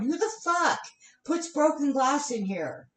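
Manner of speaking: calling out loud and high in outrage; shouting it out. Retold or imitated. Coworker yelling about broken glass in the garbage, loud outraged call. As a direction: yell it across the room at whoever left glass. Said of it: "I didn't say it that loud this time".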